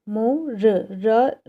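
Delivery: slow, drawn-out मोर with the र stretched at the end; drawn-out teaching demo. Shown as a demonstration. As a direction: stretch मोर so the final र rings clearly. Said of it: "last में आया".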